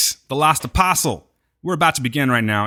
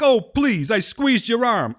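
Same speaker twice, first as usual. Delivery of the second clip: higher, slow dismissive New York guy; New York accent; 8 semitones higher, slower than usual. Retold or imitated. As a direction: brush it off like a Brooklyn husband. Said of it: "talk like they're from New York".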